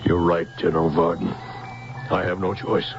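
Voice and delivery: low, subdued admission of defeat; resigned surrender. Acted. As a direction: give in, voice dropped low. Old man gives in to threat.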